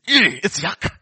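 recoiling in disgust; mock revulsion at something yucky. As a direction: say it with a revolted "eh". Acted disgust at snake's skin.

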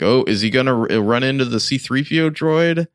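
high, sarcastic mock anticipation; mock suspense. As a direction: fake being on the edge of your seat. Mocking the chase scene's fake suspense.

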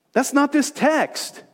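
usual speaking voice

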